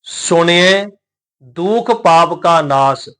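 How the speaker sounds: slow, measured recitation of sacred verse; scripture recitation. Shown as a demonstration. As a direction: recite each word drawn out, with pauses.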